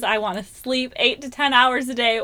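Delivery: loud, self-mocking rant; joking self-mockery. Joking to her husband about being a basket case, loud. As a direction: list your woes with comic exaggeration. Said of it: "my joke about it with my husband".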